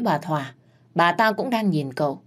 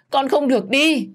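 higher, louder desperate scream; screaming wail; 9 semitones higher, 5 dB louder than usual. Acted. Mother screams at her dying son, desperate wail. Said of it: "gào lên đau đớn".

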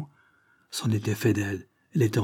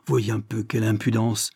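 usual speaking voice

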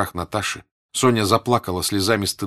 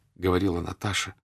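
usual speaking voice

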